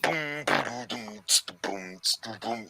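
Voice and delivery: soft mouth-drum boom and tss; quiet imitated drum beat; beatbox imitation. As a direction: tap out the beat with your mouth.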